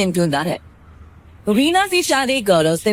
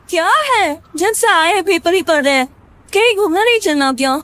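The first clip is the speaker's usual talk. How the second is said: higher, quick complaining wife; nagging wife; 8 semitones higher, 3 dB louder, faster than usual. Acted. Reena nags Gaurav reading the paper, higher and quick. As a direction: nag him to go out.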